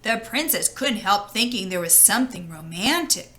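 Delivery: ordinary delivery, nothing marked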